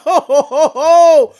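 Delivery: big loud laugh. Real, not acted. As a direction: belly laugh. Bursts out laughing at the lyric.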